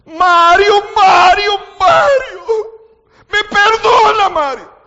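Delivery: loud, high, desperate wail of a name; a sister's grief-stricken cry over her dead brother-in-law; anguished wailing cry. Retold or imitated. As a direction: howl his name in grief. Sister's despair retold at death.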